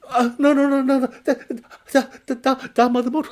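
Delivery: high, loud stammering terror; panicked stammer. Acted. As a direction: stumble over the words in fright.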